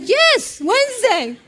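high, falling, insistent answer; emphatic confirmation. Retold or imitated. Agency caller confirming to stunned mother, emphatic.

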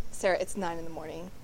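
low, lightly voiced unimpressed mom; grumpy mom. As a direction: imitate a mother turning down cake, pitched low. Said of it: "And Mom's like".

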